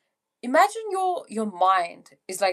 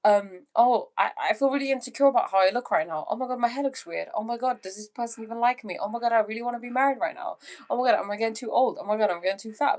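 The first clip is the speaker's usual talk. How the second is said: fast, slightly raised mock-anxious chatter; acted insecure worrying; 3 semitones higher, much faster than usual. Voicing anxious thoughts that always come in, fast mock worrying. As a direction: rattle off worries in a mock panic. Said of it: "you'll go through a state of".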